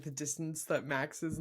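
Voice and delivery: usual speaking voice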